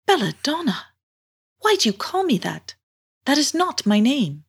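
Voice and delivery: high-pitched, puzzled mermaid voice. Acted. Mermaid answers girl who misnamed her, puzzled. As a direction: query it high, then gently deny the name.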